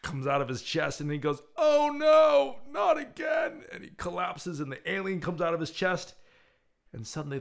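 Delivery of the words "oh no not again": high, loud hammed-up wail; mock dismay. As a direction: cry out in comic despair. Voicing diner before he collapses.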